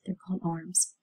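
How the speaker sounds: soft breathy whisper of a quick correction; whispered aside. Real, not acted. Teacher whispering a correction to listening kids. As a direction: drop to a whisper for a side note.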